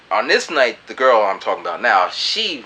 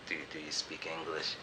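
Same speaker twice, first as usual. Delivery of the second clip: much softer and lower; shy mumble; 6 semitones lower, 20 dB quieter than usual. Retold or imitated. Shyly asking the girl at the open mic, soft. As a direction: ask it timidly, almost under breath. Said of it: "It's like".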